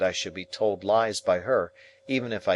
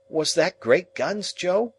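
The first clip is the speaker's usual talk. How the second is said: put-on boy's question; 11 semitones higher, 4 dB louder, slower than usual. Pip asks Joe.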